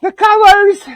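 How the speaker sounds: high, loud thrilled cry; excited shout. Real, not acted. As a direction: burst out in amazement. Echoing amazed at the colors.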